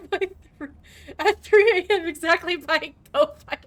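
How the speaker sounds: laughing through the words; can't stop laughing while saying it. Real, not acted. Streamer laughing as he teases his teammate.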